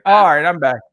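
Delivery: normal talking voice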